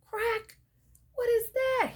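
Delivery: high, alarmed cry of fright; startled by the snap, ask in fright. Acted. Pete in the tent hearing a snap, high alarmed cry.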